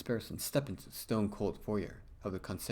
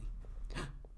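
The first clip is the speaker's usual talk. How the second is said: sharp, breathless little intake of air; frightened little gasp; startled gasp; 9 dB quieter than usual. Acted. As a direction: gasp like a surprised girl. Young lady startled.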